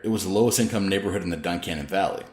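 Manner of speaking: ordinary delivery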